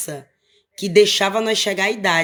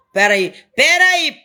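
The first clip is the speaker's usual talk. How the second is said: shouting; 5 semitones higher, 5 dB louder, slower than usual. Retold or imitated. Father calls out to fleeing kids.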